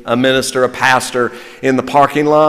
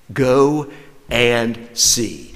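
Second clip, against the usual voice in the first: very slow Go and see; slow preaching emphasis; slow, weighty; 3 semitones lower, 5 dB quieter, much slower than usual. Shown as a demonstration. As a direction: lay each word down slowly. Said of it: "Three words, and they'll preach".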